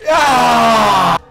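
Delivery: frustrated yell. Real, not acted. Game frustration.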